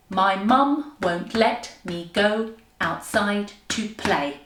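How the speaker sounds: "My mum won't let me go outside to play" in slow even beats, dropping on PLAY; slow weak-strong pulse on MUM, LET, GO, SIDE, PLAY; iambic rhythm. Shown as a demonstration. Teacher trying another example, slow scansion. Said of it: "you can use that rhythm".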